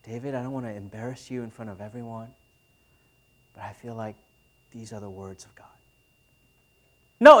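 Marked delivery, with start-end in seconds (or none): 0.0-5.7 very hushed, low and discreet; barely audible, lowered voice, breaking it to him privately; private aside. Acted. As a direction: confide it gently so nobody overhears. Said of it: "he pulls him aside".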